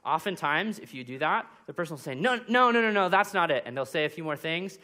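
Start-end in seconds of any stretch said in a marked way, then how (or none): 2.1-3.6 high, loud insistent denial; mock protest, higher and louder; emphatic no. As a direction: push back hard. Listener correcting you.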